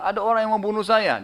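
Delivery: retold cry for help. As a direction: cry out as the man under attack. Victim cries out in retold story.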